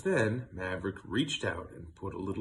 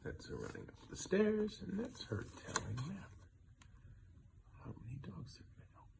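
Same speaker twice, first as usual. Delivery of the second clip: higher, fading to a whisper; soft aside; 8 semitones higher, 10 dB quieter, faster than usual. Real, not acted. Reader showing the child the page, fading to a whisper. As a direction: point at the picture, trailing into a hush.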